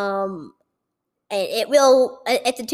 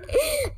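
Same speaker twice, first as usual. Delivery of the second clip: laugh; 13 semitones higher, 5 dB quieter than usual. Real, not acted. Laughing at burp joke.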